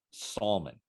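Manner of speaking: "Salmon" as SAWL-mun, L sounded; "Salmon" with an audible L; mispronunciation on request. Shown as a demonstration. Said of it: "Say it again, just one more time".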